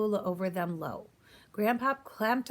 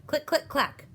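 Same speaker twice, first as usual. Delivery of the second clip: higher, louder mock clicks of a gun hammer; 8 semitones higher, 4 dB louder than usual. A narrator's reading. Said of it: "went the hammer".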